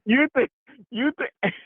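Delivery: high-pitched, breaking into laughter; too tickled to finish the question; laughing. Real, not acted. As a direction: try to ask it through real laughter. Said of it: "that's why I'm laughing".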